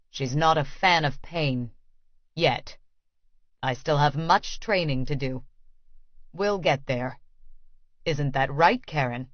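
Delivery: lightly voiced Vasily, calm and possessive; cold master. A narrator's reading. Vasily voiced through narration.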